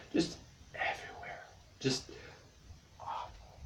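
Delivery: hushed, voiceless trailing off; whispered despair. Pretend. Summing up his awful situation, whispered mock despair. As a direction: drop to a defeated whisper.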